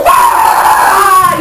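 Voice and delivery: a sharp high gasp, then a loud scream; gasp, then cry out in horror at the damage. Retold or imitated. Seeing her wrecked car in the morning, gasp then scream.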